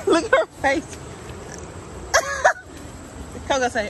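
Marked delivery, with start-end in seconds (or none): 1.0-3.4 high airy laughter; breathy laugh. Real, not acted. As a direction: laugh at her face. Laughing at muddy dog.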